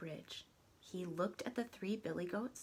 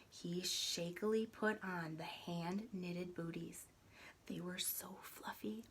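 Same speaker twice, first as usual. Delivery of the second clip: hushed; 7 dB quieter than usual. A narrator's reading. Quiet tiptoe scene.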